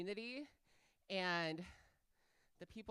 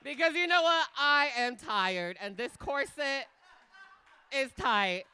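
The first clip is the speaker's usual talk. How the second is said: big, loud stage energy to the crowd; loud hype host; 5 semitones higher, 13 dB louder than usual. Acted. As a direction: pump up the audience.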